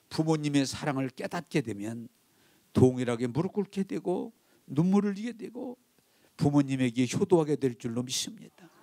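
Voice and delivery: ordinary delivery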